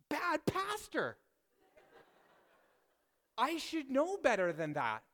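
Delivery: high, drawn-out mock scolding; mock self-scolding. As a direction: stretch it out high, playfully telling yourself off. Mock-scolding himself as pastor.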